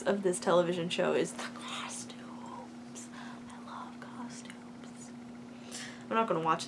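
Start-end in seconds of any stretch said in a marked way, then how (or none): 0.0-5.1 whispering. Real, not acted. Whispering over the playing clip.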